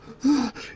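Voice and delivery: panicked gasp. Acted. Woman hyperventilating.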